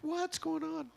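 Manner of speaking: very high falsetto shock; mock-panicked high squeak; startled retelling. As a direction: squeal it in comic bewilderment. Retold waking startled, drunk prank.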